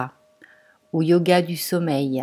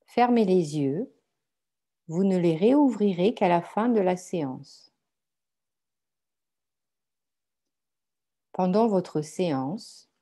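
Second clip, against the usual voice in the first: guided meditation; 4 dB quieter than usual. Acted. Guides a yoga nidra meditation. (méditation guidée, voix douce et posée)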